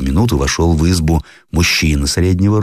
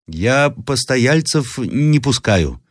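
slow, high granny voice; refusing grandmother; 9 semitones higher, much slower than usual. A narrator's reading. Grandmother turns traveller away, slow refusal. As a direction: refuse it slowly and firmly.

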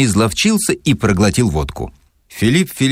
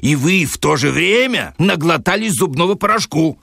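much higher furious tirade; outshouting; 10 semitones higher than usual. Acted. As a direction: roar him down, higher and higher. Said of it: "перекричал Филипп Филиппович". (Филипп Филиппович перекрикивает, гораздо выше)

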